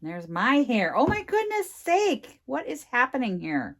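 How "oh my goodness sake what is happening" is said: high exasperated cry; exasperated squeal. Real, not acted. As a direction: throw up your hands at it. Hair on project, exasperated.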